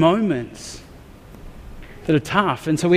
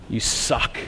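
muttered complaint; 4 semitones lower, 6 dB quieter than usual. Pretend. Voicing an angry prayer to God.

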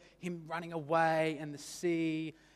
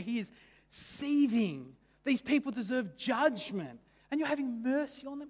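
seething; 8 semitones higher, 5 dB louder than usual. Retold or imitated. Preacher voices angry Jonah.

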